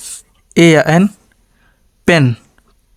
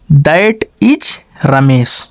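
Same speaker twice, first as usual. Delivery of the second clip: very slow, word by word; slow dictation; 2 semitones lower, much slower than usual. Shown as a demonstration. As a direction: spell out "that is Ramesh" slowly for the class. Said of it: "teacher dictates the answer sentence".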